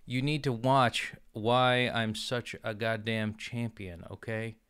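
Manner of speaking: ordinary delivery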